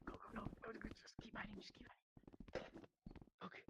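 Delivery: urgent whisper, no voice; breathless whispered urging. Real, not acted. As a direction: hiss them onward while hiding. Urging hiding in game.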